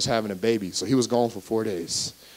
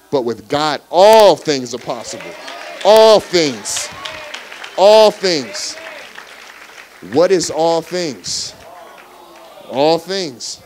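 loud, higher preacher's cry; preaching at full force; 3 semitones higher, 7 dB louder, much slower than usual. Acted. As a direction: hammer all things home. Preaching climax on all things.

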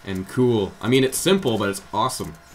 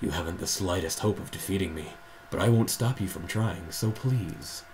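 scorn the knight in a hushed, lowered voice; shadow voice; 2 semitones lower than usual. Acted. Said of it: "Do your shadow voice".

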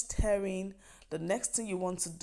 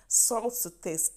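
SALT TO TASTE slowed right down and pitched higher; emphatic repeat; 4 semitones higher, slower than usual. Real, not acted. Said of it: "emphasis on salt to taste".

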